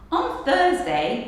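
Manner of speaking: usual speaking voice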